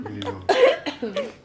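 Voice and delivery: high stretched voice. Shown as a demonstration. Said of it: "I can really relax them".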